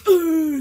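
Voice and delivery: hammed-up Voldemort's death groan; dying villain groan. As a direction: groan as the villain melts away. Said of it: "Voldemort's like".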